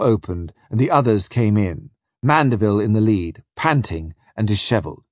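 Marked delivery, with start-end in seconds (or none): none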